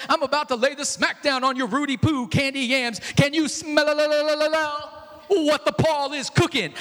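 loud wrestler trash-talk promo; The Rock. Retold or imitated. Preacher doing The Rock taunting the Galatians, loud wrestler voice. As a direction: boom it out like a pro wrestler cutting a promo. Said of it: "put the voice of The Rock".

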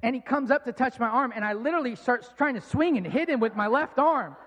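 normal talking voice

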